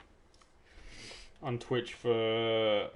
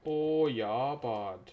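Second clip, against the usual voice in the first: ordinary talk, nothing marked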